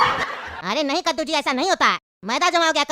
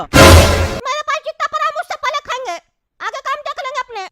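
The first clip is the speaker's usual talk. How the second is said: high-pitched cartoon child voice; squeaky kid brushing someone off; high, stubborn child; defiant little boy; 12 semitones higher, 7 dB louder than usual.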